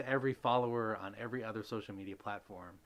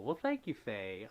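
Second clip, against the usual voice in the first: ordinary talk, nothing marked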